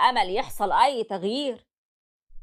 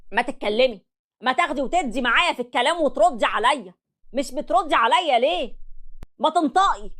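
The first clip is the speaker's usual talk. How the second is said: angry yelling; 5 semitones higher than usual. Acted. Husband keeps yelling at wife.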